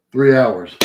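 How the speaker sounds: ordinary talk, nothing marked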